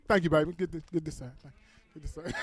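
low murmured aside off mic; quiet aside. Real, not acted. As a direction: mutter it off to the side. Aside to stage helper.

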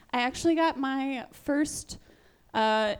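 ordinary talk, nothing marked

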